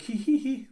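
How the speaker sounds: high, put-on hee-hee titter; imitated giggle. Woman giggling when told to calm down, imitated giggle. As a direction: mimic a pleased little giggle.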